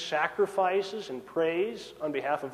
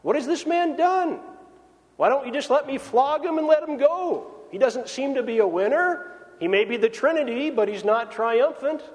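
a higher, pleading voice for Pilate the governor; 5 semitones higher than usual. Retold or imitated. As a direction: play Pilate bargaining with the crowd, a touch higher. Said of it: "You'll hear Pilate the governor ask".

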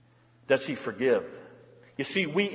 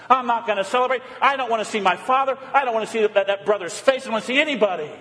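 a higher, stubborn refusal from the bitter son; cold refusing son; 6 semitones higher, 3 dB louder, faster than usual. Acted. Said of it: "holding a grudge".

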